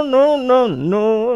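normal talking voice

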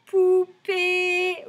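Poupée high and loud, both syllables held; drawn-out syllables. Shown as a demonstration. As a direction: sing out POU, PÉE slowly. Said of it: "dans le deuxième".

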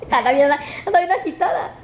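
ordinary talk, nothing marked

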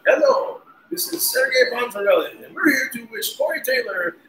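high, put-on made-up foreign voice; mock foreign host. Drummer's birthday message as Sergei Ponzarelli: high mock foreign voice. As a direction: ham up a fake announcer. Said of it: "a mashup of all different languages in one".

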